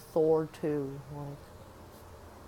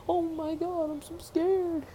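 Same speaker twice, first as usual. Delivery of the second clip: much higher hammed-up fright; mock fear; 12 semitones higher, 3 dB louder than usual. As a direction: fake being scared, over the top. Mocking the movie's fake fear.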